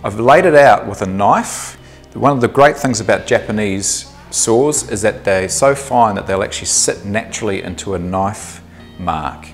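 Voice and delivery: usual speaking voice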